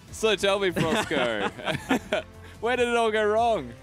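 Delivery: teasing laugh. Real, not acted. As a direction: tease him through laughter. Host teasing the losing pick.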